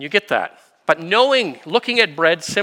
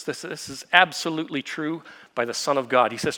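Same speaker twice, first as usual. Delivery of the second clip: ordinary talk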